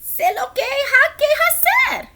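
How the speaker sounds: high, loud bird voice. Acted. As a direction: squeak it out like the bird. Bird voice in Spanish.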